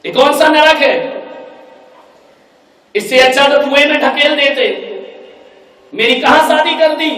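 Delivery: loud, high furious complaint; angry daughter. Acted. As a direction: wail it out at the new house. Daughter complains about in-laws.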